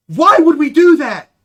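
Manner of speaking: loud, high exclamation; hammed-up incredulous yell; outraged disbelief. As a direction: shout it like a stunned official on the phone. Officials acted answering the phone.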